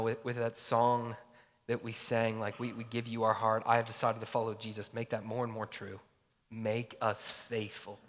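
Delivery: low, soft prayer voice. Real, not acted. Pastor praying to God for faithfulness, hushed prayer. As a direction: pray it quietly and humbly.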